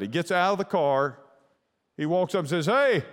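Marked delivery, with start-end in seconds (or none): 2.7-3.0 sharp high call, a little louder. Retold or imitated. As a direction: call out to a stranger in the dark. Cop calls out to produce guy.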